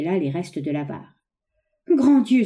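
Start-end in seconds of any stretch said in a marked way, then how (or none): 1.9-2.4 high, loud cry of dismay; alarmed cry. A narrator's reading. Young woman finding a charred skeleton, alarmed cry. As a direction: cry out in horrified realisation. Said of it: "s'écria-t-elle".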